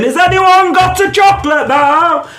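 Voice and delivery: high, drawn-out call to a whole bus; hammed-up public appeal. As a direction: slowly sing out a plea to strangers. Sister calling out to whole bus.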